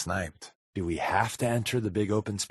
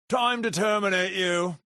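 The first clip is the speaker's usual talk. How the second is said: mock terminator; 9 semitones higher than usual. Robot movie quote.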